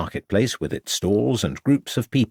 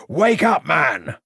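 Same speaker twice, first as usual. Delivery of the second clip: sharp high shout to wake him; 7 semitones higher, much slower than usual. A narrator's reading. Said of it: "shouted Ravenscroft".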